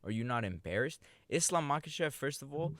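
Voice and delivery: ordinary delivery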